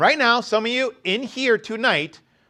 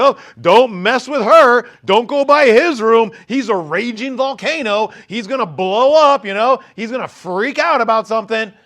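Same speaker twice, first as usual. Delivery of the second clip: mock warning; 4 semitones higher, 8 dB louder than usual. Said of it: "You're like".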